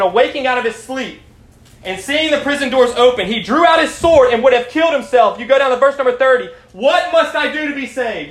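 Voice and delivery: high, loud preacher voice; urgent sermon at full projection; fired-up preaching. Acted.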